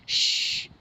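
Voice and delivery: sharp, loud hushing shh; loud shush. Acted. Khushi hushing crying Pari, loud shush. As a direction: hush her at once with a loud shh.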